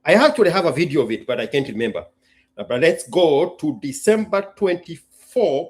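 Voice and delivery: ordinary delivery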